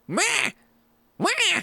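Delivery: odd, high bleating cat meow; Mochi. Retold or imitated. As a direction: imitate a cat who never learned to meow. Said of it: "so odd and weird because she just goes".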